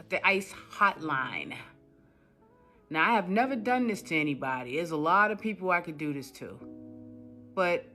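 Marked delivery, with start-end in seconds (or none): none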